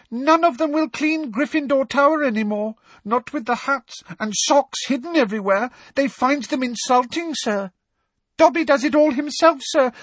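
very high, eager elf chatter; a devoted elf pouring out his news; squeaky house elf. Acted. Dobby telling Harry about the elves, high and eager.

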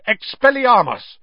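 loud, high spell cry; shouted spell. A narrator's reading. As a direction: fire the spell. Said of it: "He pulled out his wand and shouted".

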